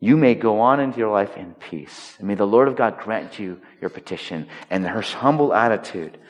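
usual speaking voice

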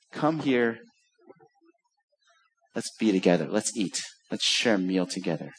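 low, soft and gentle; tender invitation. Acted. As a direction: invite them in kindly.

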